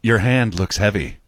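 slow, low-pitched come-on; pickup-line voice. Acted. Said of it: "why do I do it in that voice".

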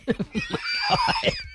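shrieking high laugh; high squealing laugh. Retold or imitated. Granddaughter cracking up at grandma's flirting, squealing laugh. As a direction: let out a shrill squealing laugh.

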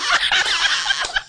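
a sudden high delighted laugh; high burst of laughter. Real, not acted. Co-host laughing in delight at the guest's story, high burst of laughter.

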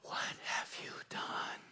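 soft, breathy devil's whisper; sly, hushed voice of the enemy; seductive whisper. Acted. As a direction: whisper it like a tempter in the ear. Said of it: "in that seductive voice".

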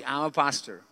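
mumbled confession behind a hand; mouth covered. Retold or imitated. Embarrassed pastor admitting his job to his doctor, mouth covered. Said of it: "me tapo la boca y yo hago así".

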